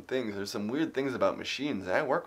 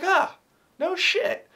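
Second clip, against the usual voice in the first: a hint of a Southern good old boy, pitched up; 7 dB louder, much slower than usual. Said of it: "a very Southern hick type of character".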